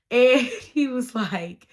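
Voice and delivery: laughing mid-sentence. Real, not acted. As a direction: chuckle through the words.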